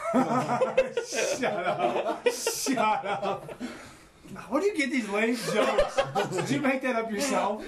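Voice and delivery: helpless laughter running through the words; laughing groan at joke. Real, not acted. Friend reacting to a lame [name] joke, laughing. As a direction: tell them off while cracking up.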